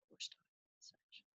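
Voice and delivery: quick whispered repeat to herself; whispered aside. Real, not acted. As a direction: mutter it under your breath.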